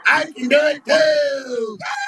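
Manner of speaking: put-on high, slow childish whine ending in a yelp; whiny copycat Florida. Florida in a tiny cowboy hat copying Texas, whiny. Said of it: "then here comes Florida".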